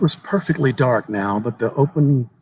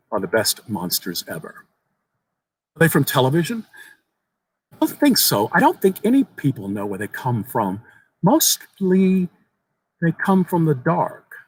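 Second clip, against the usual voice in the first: a hint of a small earnest boy, raised pitch; lightly voiced young boy; 5 semitones higher than usual. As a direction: tell it like an eager child sharing a secret.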